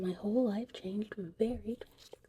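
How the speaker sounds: ordinary talk, nothing marked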